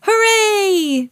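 put-on high, long child hooray; cheering. A narrator's reading. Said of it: "cheered Peppa".

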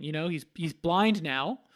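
normal talking voice

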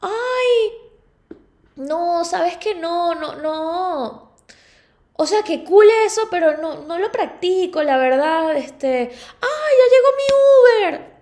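high, loud panicky dodge; flustered high-pitched reaction. Retold or imitated. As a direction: squeal it out, then flee. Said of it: "Esa fue mi reacción".

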